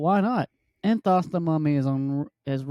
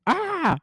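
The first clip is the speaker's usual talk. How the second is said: mock scream. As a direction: yelp in fake surprise as you turn round. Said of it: "turned around and goes".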